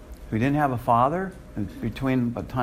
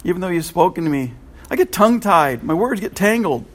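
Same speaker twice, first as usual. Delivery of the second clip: higher, louder anxious plea; pleading; 5 semitones higher, 4 dB louder than usual. Retold or imitated. Moses pleading with God about his words, higher and louder. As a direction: fret about your speech. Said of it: "Moses pleaded".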